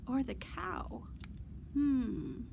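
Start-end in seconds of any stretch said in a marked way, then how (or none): none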